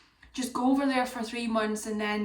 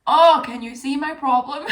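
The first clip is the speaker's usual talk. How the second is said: exasperated; 4 semitones higher, 11 dB louder than usual. Real, not acted. Exasperated outburst.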